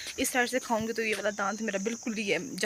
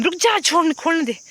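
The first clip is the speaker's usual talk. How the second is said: loud scolding; 7 semitones higher, 8 dB louder than usual. Real, not acted. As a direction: shout high and loud to stop the animal. Scolding the animal at the gate. (ऊँची आवाज़ में डाँटना)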